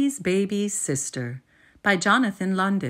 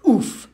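short punchy grunt of a fall; cartoon oof on impact; 5 semitones higher, 8 dB louder than usual. A narrator's reading. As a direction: land flat and grunt. Sound of falling.

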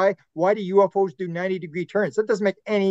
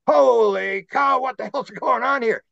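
high, slow gasp of disbelief; mock astonished onlooker, pitched up; amazed exclamation; 6 semitones higher, slower than usual. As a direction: stretch it out, higher, like a stunned witness. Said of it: "they want you to go".

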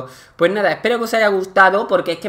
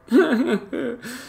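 short resigned laugh, higher; rueful laugh; 6 semitones higher, 3 dB quieter than usual. Real, not acted. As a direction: laugh it off bitterly. (risa resignada)

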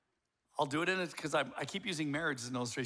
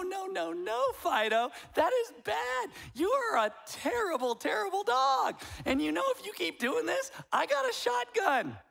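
much higher, louder cooing sing-song while threatening; sweet baby talk to a dog; 13 semitones higher, 3 dB louder than usual. Retold or imitated. Owner scolding his dog Fido in high cooing baby talk. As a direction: coo the threats like a doting pet owner. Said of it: "and you go".

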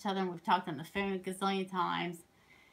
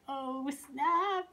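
higher, put-on "oh snap"; mock dismay; 6 semitones higher than usual. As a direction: play up the letdown in a higher voice. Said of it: "I'm like".

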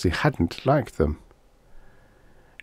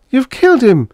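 very high, much louder anguished cry; 7 dB louder than usual. Acted. Dana crying out on her knees over the dead monster. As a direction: wail it through tears. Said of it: "she cried".

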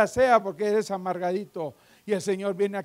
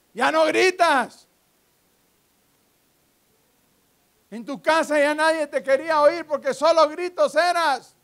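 high, raised, confronting sermon voice; forceful preaching; 6 semitones higher, 6 dB louder than usual. Real, not acted. Preacher confronting the household shouter, forceful preaching. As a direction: challenge the congregation head-on.

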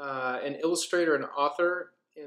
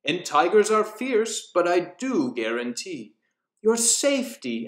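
rhythmic storybook reading with character voices; lilting Halloween storyteller; story narration; 4 semitones higher, 4 dB louder than usual. A narrator's reading. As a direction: read the rhyme like a spooky bedtime tale. Reads nursery rhyme aloud.

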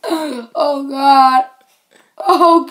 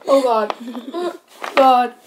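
ordinary talk, nothing marked

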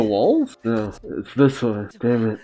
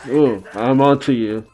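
normal talking voice

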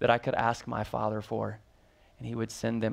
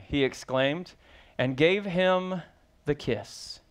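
ordinary talk, nothing marked

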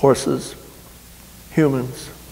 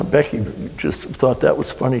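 ordinary delivery

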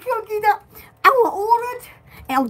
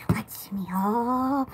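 whispered aside; hushed aside while clicking; 9 semitones lower, 10 dB quieter, much slower than usual. Real, not acted. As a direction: breathe it softly.

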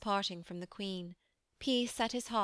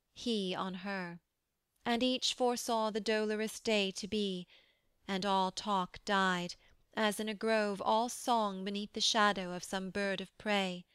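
ordinary delivery, nothing marked

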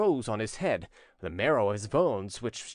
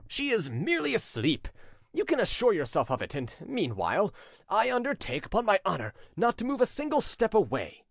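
higher-pitched, dignified assurance; cold dignity; 7 semitones higher than usual. A narrator's reading. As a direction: pledge it with princely dignity, pitch lifted. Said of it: "said the prince with cold dignity".

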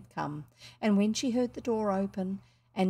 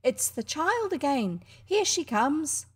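higher and louder, slowly called out; a blind old woman calling out with joy; glad call; 7 semitones higher, 5 dB louder, slower than usual. A narrator's reading. Grandmother greets Heidi from her corner, slow joyful call. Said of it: "the grandmother called out".

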